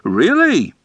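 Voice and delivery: sharp high surprised "Really?"; startled, high question. A narrator's reading. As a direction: let it jump up in surprise. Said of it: "Gorfinkel sounded surprised".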